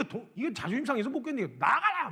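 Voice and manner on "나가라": high, loud yell of get out; imitating a furious teen shouting; shouted get out. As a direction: bark it like an insulted teen. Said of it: "막 난리를 쳤어요".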